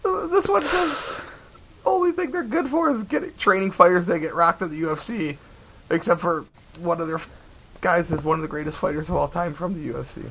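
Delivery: high squeaky voice after laughing; squeaked-out review reading, barely over a laugh; recovering from laughter. Real, not acted. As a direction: read on while fighting back the giggles.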